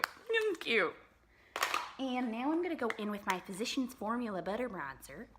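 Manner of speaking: usual speaking voice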